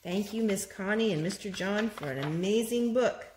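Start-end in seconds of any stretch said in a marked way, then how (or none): none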